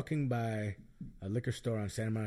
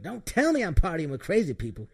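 high woman voice; 6 semitones higher, 6 dB louder than usual. Retold or imitated. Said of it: "She's like".

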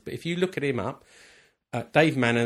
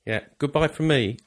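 ordinary delivery

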